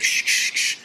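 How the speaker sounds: retold hushing whispered shh; imitating shushing. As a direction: whisper the shushing like the film character. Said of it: "he's like".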